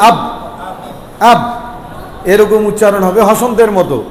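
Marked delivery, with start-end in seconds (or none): 0.1-1.4 আব halted on a closed b, no vowel after; stopped final b. Shown as a demonstration. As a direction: shut the lips on the b of আব and stop. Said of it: "হসন্ত দিয়া দিলে এখানে থাইমা যেতে হবে".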